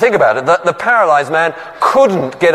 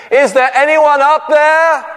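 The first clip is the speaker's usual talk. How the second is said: high, louder call to the sky; calling upward; 11 semitones higher, 3 dB louder than usual. Retold or imitated. Man on the cliff calls up to heaven, high and hopeful. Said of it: "looking up, he said".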